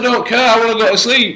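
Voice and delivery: loud, high-pitched protest; exasperated retort. Retold or imitated. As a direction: shout back from bed at an intruder. Arguing with the intruder from bed.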